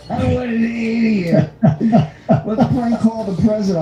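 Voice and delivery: high, slow words broken by real laughter; cracking up while mocking; laughing too hard to talk straight. Real, not acted. Laughing at a dumb prank call.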